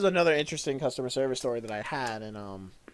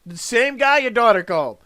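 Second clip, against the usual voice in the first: loud, higher deadpan retort; 7 semitones higher, 10 dB louder than usual. Retold or imitated. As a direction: throw it back at her. Retold reply in quarrel.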